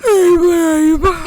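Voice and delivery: high, loud yawned greeting; hammed-up yawn. Tired girlfriend greeting boyfriend through a yawn. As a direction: stretch it out into a big mock yawn. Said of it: "ending up yawning into it".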